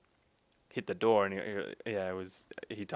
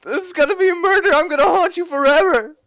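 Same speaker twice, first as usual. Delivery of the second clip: much higher, loud hammed-up wail; mock dramatic shout; 14 dB louder than usual. Player yelling at friends while dying in game, hammed-up wail. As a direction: threaten to haunt them, over the top. Joking death threat in game.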